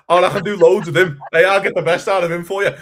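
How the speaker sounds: loud, high, eager manager sales pitch; mock manager promise. Manager pitching at his interview, loud mock promise. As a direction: oversell it like a smug new boss. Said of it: "You were saying".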